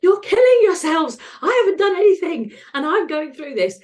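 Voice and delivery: high, loud angry yelling at smokers; angry outburst. Retold or imitated. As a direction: shout it furiously, pitched high. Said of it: "I'd be like".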